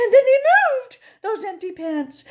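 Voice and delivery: high, breathless fright; spooked. Acted. Narrator seeing empty pants move, high scared voice.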